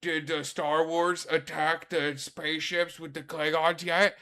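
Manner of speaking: loud, high clueless voice; mock airhead voice, loud; dumb brain voice. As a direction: play your own clueless inner dummy. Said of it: "that's what my dumb brain does".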